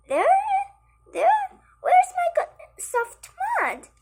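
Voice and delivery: very high, loud little pig; small pig voice. Acted. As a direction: squeal it like a worried little pig. Small pig looks for his mud.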